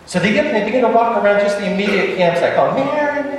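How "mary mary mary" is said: calling out. Retold or imitated. Calling for the lost girl.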